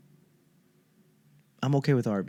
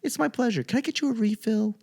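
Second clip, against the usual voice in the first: much higher, soft, chirpy server voice; sweet as can be, all service; 9 semitones higher, 4 dB quieter than usual. Retold or imitated. Comic imitating a Chick-fil-A worker, sweet and high. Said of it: "they're so pleasant. They're like".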